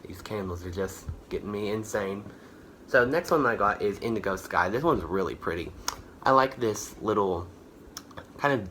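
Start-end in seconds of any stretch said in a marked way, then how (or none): none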